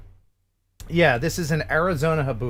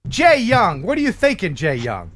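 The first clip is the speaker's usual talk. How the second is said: mock scolding; 7 semitones higher than usual. Mock scolding listener over fart.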